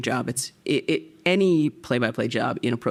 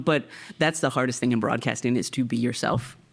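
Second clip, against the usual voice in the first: ordinary talk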